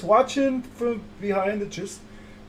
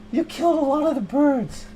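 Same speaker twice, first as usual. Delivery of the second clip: indignant; 7 semitones higher than usual. Acted. Improv, repeated louder, higher.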